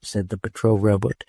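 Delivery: ordinary delivery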